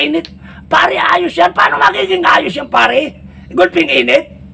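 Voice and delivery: put-on high shouting drunk; complaining drunk in hell. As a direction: whine over the burning fire. Drunks complain of hell's heat.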